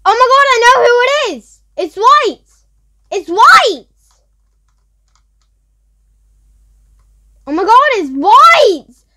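loud, high, fast cry of realization; excited discovery. Real, not acted. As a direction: shout it as you figure it out. Realizes who the impostor is.

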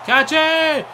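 high loud shout of catch it; yelling at the screen. Real, not acted. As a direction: scream it at the fielder. Yelling at fielder in game.

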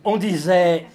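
loud, declaimed opening of a refrain; ringing recited phrase; emphatic refrain. Acted. Refrain built up then faded.

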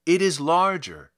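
a touch of an amazed shout; crying out. A narrator's reading. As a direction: call it out in wonder. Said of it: "they cried".

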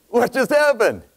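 high, loud shocked cry of no; shocked shout. Retold or imitated. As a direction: yell out no in disbelief, then gasp what happened. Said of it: "And I'm like".

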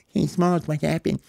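ordinary delivery

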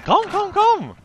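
high sing-song call to a bird. Real, not acted. Player calling a bird over, high sing-song call.

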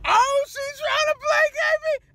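high, shouting, laughing-crying vent; yelling in frustrated disbelief; half-laughing outrage. Real, not acted. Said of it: "I had to vent".

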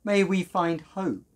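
usual speaking voice

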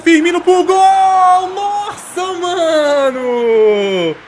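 high, loud, slow near-miss shout; shouted commentary. Real, not acted. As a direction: scream the header chance. Header nearly scores.

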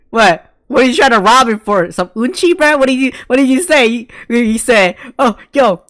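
high, loud incredulous yell; loud disbelief. Real, not acted. Streamer yelling at the anime character, loud disbelief. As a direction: shout your disbelief at the screen. Reacting loudly to anime scene.

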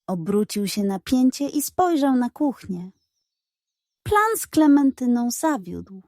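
ordinary delivery

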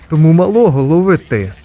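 loud shouted order; urgent call. Acted. Ordering someone to catch the kid, loud urgent call. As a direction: call it out forcefully to a helper.

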